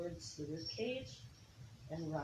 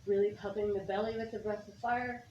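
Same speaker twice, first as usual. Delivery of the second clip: energetic coaching cues; 4 semitones higher, 5 dB quieter than usual. Acted. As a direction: push the class through the breath. Yoga teacher calls out breath cues.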